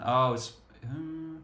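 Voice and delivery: ordinary talk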